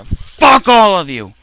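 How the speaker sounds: loud, slow angry yell; irritated yelling. Real, not acted. Gamer yelling at the mob of enemies, angry shout. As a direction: bellow it at the whole mob. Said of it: "I'm getting a little irritated".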